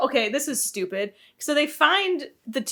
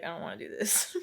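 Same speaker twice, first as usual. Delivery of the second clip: sulky mumble; 3 semitones lower, 11 dB quieter than usual. Retold or imitated. Sulky son refusing the trip.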